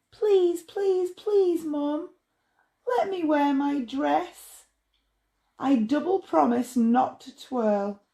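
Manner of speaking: put-on high, pleading little girl; begging child. A narrator's reading. Little girl begging Mum to wear dress, high and pleading. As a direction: beg Mum sweetly and insistently.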